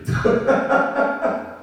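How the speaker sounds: breathy high laugh; an amused laugh at the text. Real, not acted. Preacher chuckling at the detail.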